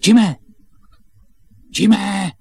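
loud, high shout of a name; calling out. Acted. Boys searching the farm for Jim, calling out. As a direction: shout to reach someone out of sight.

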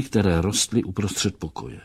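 ordinary delivery, nothing marked

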